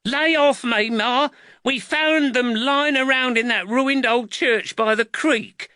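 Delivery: high-pitched, aggrieved lad; indignant boy defending himself; protesting boy. A narrator's reading. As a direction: whine back at your mother, voice high. Cuffed boy protests to his mother.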